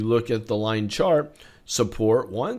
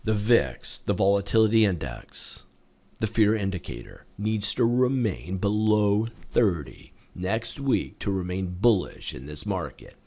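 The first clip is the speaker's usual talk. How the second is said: low, softer, measured delivery with pauses; lowered voice, phrase by careful phrase; very slow, deliberate; 5 semitones lower, 5 dB quieter than usual. Shown as a demonstration. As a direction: spell it out slowly so nobody misses it. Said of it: "I'll say it very slowly".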